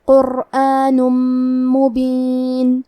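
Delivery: قرآن مبین joined, the ن merged into a doubled nasal م, مبین very slow; idgham with ghunna. Shown as a demonstration. Teacher demonstrating idgham into meem to students, slow nasal hum. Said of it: "غنہ اور تشدید کے ساتھ ادا کریں گے".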